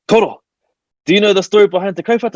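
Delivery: high, quick question after calling the name; imitated phone call-out. Woman phoning her just-woken koro, high imitated call-out. Auntie calls sleepy grandfather on phone.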